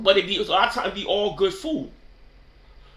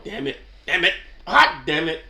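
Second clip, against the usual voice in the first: usual speaking voice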